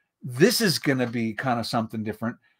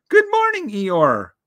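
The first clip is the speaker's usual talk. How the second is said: very high, louder bear voice; Pooh's cheery greeting; 7 semitones higher, 5 dB louder, slower than usual. A narrator's reading. Pooh Bear greeting gloomy Eeyore, high and cheery. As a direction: pipe up as a friendly little bear.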